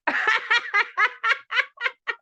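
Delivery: high, loud burst of laughter; real laugh. Real, not acted. Host cracking up at her guest, high laugh.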